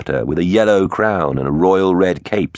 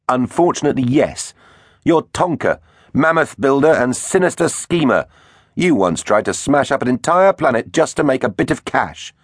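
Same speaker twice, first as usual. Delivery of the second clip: high-pitched bold accusation; brave defiance; 9 semitones higher than usual. A narrator's reading. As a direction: stand up to the villain, voice raised in pitch. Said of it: "stepped forward bravely".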